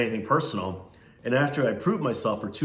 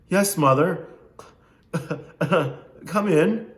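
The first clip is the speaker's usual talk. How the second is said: put-on mama's boy; 8 semitones higher, 6 dB louder, slower than usual. Spoiled son answers mother.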